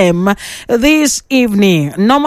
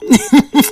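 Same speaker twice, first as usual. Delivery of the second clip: amused laugh; 2 semitones higher than usual. Real, not acted. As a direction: chuckle at the caller's answer. Amused at caller's doubtful promise.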